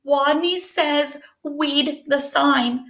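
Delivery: slow, timid mouse voice saying "Weed"; squeaked. Acted. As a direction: squeak it out shyly, slowly. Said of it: "and squeaked".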